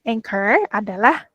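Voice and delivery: ordinary talk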